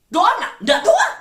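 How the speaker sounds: incredulous exclamation. Real, not acted. Preacher exclaims at prayerless listener.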